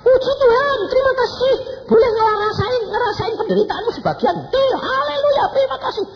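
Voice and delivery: very high, loud shrieking thanks; hammed-up screaming hallelujah; screamed praise. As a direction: shriek praise at the top of your voice. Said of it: "aku kok teriak-teriak".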